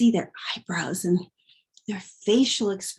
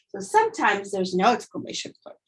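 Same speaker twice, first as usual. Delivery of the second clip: normal talking voice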